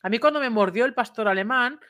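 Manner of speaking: ordinary talk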